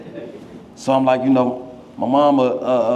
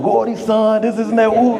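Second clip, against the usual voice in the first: cheering teammates; 7 semitones higher than usual. Retold or imitated. Teammates cheering after touchdown.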